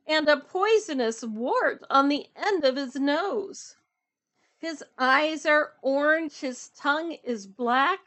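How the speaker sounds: emphatic, lively storybook reading; picture book read-aloud. A narrator's reading. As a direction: punch the rhyming words for young listeners. Reads picture book aloud to children.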